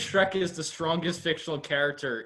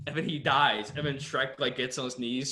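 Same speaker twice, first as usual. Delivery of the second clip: ordinary talk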